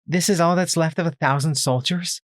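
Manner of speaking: shocked. A narrator's reading. Twist echoing the soldier, shocked and disbelieving. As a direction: echo the horror in disbelief. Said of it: "echoed her shocked tone".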